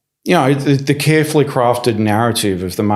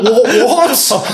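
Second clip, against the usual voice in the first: incredulous what; 13 semitones higher, 3 dB louder than usual. Retold or imitated. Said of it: "I was like".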